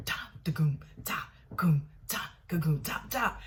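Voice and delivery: spoken drum groove imitation; vocal drum beat. Showing what was played on the kit, mouthed drum beat. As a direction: tap out a kick-and-snare beat with the voice.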